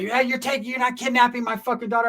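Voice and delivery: high, shrill-pitched angry mom voice; mother accusing him of kidnapping, high angry shriek; screeching mother. Retold or imitated. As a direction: imitate her yelling at him. Mother screaming in retold quarrel.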